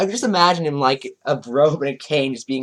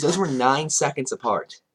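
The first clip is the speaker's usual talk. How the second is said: ordinary delivery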